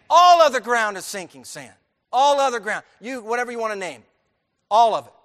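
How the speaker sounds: loud, ringing preacher's cry; booming pulpit proclamation; preaching at full force. Acted. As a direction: hammer the hymn line home to the church.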